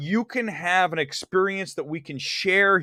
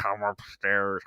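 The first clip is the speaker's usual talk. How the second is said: low eerie ghostly voice; haunting voice; 10 semitones lower, 5 dB quieter than usual. Retold or imitated. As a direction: beckon in a low spectral voice. Imitating film ghost voice.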